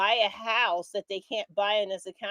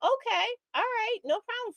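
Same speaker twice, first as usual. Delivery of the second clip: much higher sarcastic okay; mock agreeable; 13 semitones higher than usual. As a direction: play along with exaggerated sarcasm.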